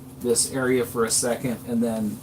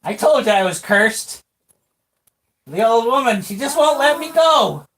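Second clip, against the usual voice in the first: high squeaky voice, a bit louder; squeaky old man; 9 semitones higher, 7 dB louder than usual. Acted. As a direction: whine about the curse in a squeak. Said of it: "he's like".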